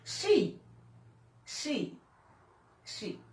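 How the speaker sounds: high, fading repeats with long pauses; echo-like poetic repetition, dying away; poem recitation. Acted. Recites her poem, word repeated.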